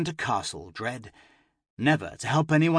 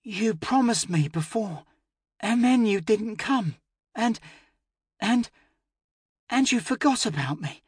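put-on hurt little girl; 9 semitones higher than usual. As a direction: stammer out the hurt like a child. Sick child reproaches, stammering.

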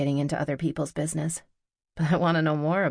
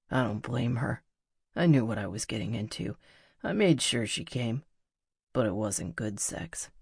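low, subdued voice for the musician; confessing male lover; 4 semitones lower than usual. Acted. Musician admits the night fell short, low and subdued. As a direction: confess it in a lowered voice. Narrator continues Pete's confession.

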